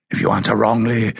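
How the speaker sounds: lightly voiced stern guardian laying out terms; rasping guardian. A narrator's reading. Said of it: "in a low, rasping voice".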